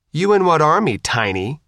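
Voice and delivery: mocking challenge.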